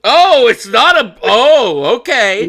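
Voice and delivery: high, drawn-out surprised oh. Real, not acted. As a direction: caught off guard by the twist. Surprised by a plot twist.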